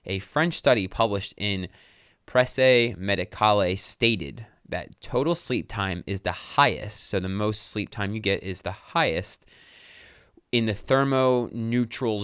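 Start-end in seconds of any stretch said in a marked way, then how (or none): 0.0-7.1 reading slowly and carefully; slowed down. Shown as a demonstration. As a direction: take it slower this time, word by word. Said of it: "I need to slow down when I'm reading".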